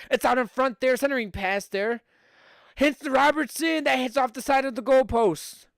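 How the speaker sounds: excited call. Acted. Streamer calling a shot off the goalpost, excited high call. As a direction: erupt as the puck hits the post.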